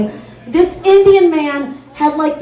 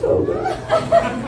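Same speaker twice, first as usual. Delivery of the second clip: high, hushed squeak of shock; mock horrified squeal; 13 semitones higher, 6 dB quieter than usual. Comic daughter reacting to her mom's story, shocked squeal. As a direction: squeal it out, half laughing. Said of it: "I'm like".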